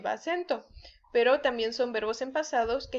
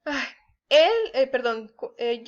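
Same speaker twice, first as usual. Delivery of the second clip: ordinary talk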